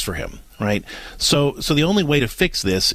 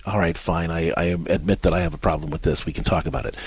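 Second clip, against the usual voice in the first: grudging husband; 7 semitones lower, 3 dB quieter than usual. Retold or imitated. Husband finally gives in.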